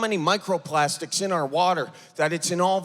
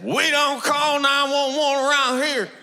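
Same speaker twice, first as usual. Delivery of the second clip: put-on Southern accent; 6 semitones higher than usual. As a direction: read it like a good old boy from the South. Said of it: "I just gave that bumper sticker a Southern accent".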